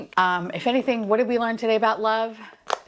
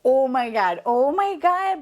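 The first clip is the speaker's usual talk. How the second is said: usual speaking voice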